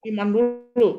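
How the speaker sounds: repeating the reply with a lift of enthusiasm; excited confirming echo. Real, not acted. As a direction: cheer the answer back to them. Pastor echoes congregation's answer.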